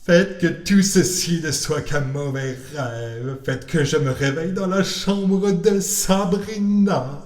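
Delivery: high, slow begging cat voice; pleading cat. Acted. Frightened cat begging to go after the parrot. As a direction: beg to be spared like a scared cat. Salem prays it's a dream.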